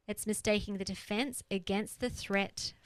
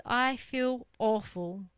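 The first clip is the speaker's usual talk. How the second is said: a mopey puffer fish, slow and a bit louder; moping puffer fish; 3 semitones higher, 4 dB louder, slower than usual. Acted. Puffer fish complaining to you, slow and glum. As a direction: drag it out like a sorry little fish.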